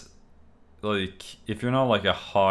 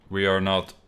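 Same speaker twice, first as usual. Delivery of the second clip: ordinary talk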